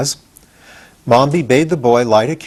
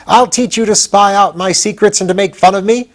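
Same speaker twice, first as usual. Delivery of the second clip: higher, loud angry scolding; furious threat; 9 semitones higher, 4 dB louder than usual. Acted. Witch Mombi threatening Tip, angry and higher. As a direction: threaten him, still furious.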